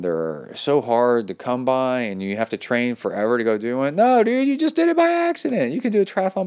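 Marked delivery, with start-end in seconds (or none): 4.0-6.5 high-pitched, incredulous correction; emphatic exclaiming. Real, not acted. Host telling a listener triathlons needn't be hard, high excited protest.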